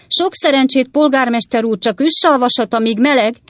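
loud, high mocking heckle; shouted jibe. A narrator's reading. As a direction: yell it to interrupt the mayor. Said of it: "kiáltotta a hang".

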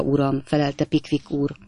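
ordinary delivery, nothing marked

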